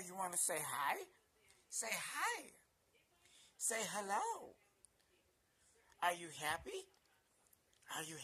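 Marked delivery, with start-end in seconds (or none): 1.7-4.5 high-pitched coaxing to a pet; puppy talk. Real, not acted. Owner coaxing puppy to say hello, high puppy talk. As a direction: coax the puppy, voice lifted.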